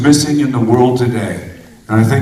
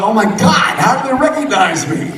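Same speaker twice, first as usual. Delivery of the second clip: a hammed-up gasp of amazement, pitched higher; mock surprise; 9 semitones higher than usual. Joking to the crowd about his disguise, with mock surprise. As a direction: play up being amazed they know you, higher.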